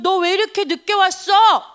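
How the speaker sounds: acted exasperated scold; mock scolding. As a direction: play an abbot demanding an explanation. Abbot scolds the late monk.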